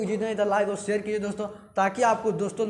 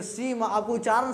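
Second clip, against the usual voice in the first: ordinary talk, nothing marked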